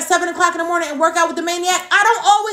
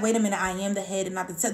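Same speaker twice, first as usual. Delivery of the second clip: quiet mock affirmation; 10 semitones lower, 10 dB quieter, faster than usual. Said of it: "nobody be like".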